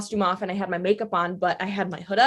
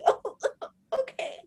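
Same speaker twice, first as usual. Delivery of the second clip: laughing okay; 4 dB quieter than usual. Retold or imitated. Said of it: "And I was like".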